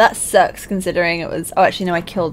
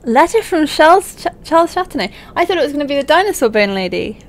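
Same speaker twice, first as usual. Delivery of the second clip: high, loud, surprised reading of the letter name; excited surprise; 8 semitones higher, 7 dB louder than usual. Real, not acted. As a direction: caught off guard by who wrote it.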